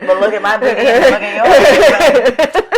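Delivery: answering staring passengers with slow sass; sassy comeback. Retold or imitated. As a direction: brag with a smirk.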